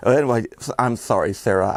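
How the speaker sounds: ordinary talk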